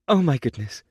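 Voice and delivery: high, rising dismay; a startled nursemaid; shocked nursemaid. A narrator's reading. Nursemaid sees the dirty trotter, high shocked dismay. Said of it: "Miss Bennington asked".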